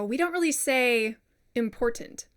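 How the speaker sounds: ordinary talk, nothing marked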